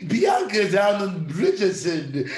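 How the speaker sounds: put-on loud, drawn-out aristocratic English accent; posh British parody. Gossiping about Bianca as a society lady, posh British parody. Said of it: "you're very posh".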